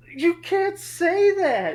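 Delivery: very high, loud, drawn-out scolding; imitated shocked teacher. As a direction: mimic an appalled teacher stretching each word. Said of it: "finally she's like".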